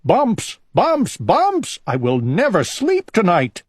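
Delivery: high panicked shriek of bumps; panicked shout. A narrator's reading. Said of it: "shouted Owl".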